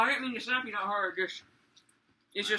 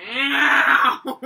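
pained yelp; 3 semitones higher, 13 dB louder than usual. Real, not acted. Just got slapped in the game.

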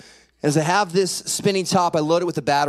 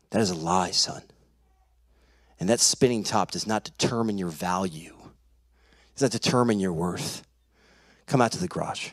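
low, measured dad voice; quietly firm, lowered father's voice; grave father; 10 semitones lower, 5 dB quieter than usual. Retold or imitated. Dad tells son the top doesn't define him, low and grave.

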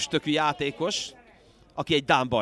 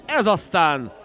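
excited hungarian sports commentary; 2 semitones higher, 7 dB louder than usual. Real, not acted. After the near miss.

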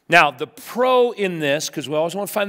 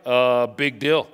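lower, slow, dismissive shrug in the voice; unimpressed wife; 7 semitones lower, slower than usual. Retold or imitated. Preacher imitating his wife shrugging off his cleaning, slow and dismissive. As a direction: drawl it out, totally unbothered. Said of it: "She walks by like".

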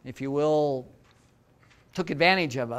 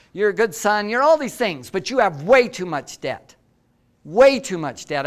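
dad's stern warning; 4 semitones higher, 6 dB louder than usual. Retold or imitated. Dad lecturing son on debt.

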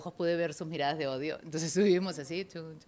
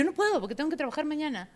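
frantic protest; 8 semitones higher than usual. Retold or imitated. Passenger and attendant arguing about getting off, high-pitched.

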